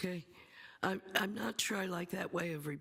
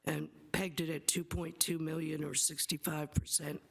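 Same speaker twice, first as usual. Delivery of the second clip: ordinary talk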